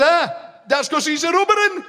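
very high put-on uncle voice; joker uncle. Uncle explaining the rubber tart gag, very high. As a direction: crow the punchline like a proud prankster.

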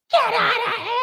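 high yell to scare them off; yelling at coyotes. Retold or imitated. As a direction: bark them away, pitched high. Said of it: "yelling and flailing".

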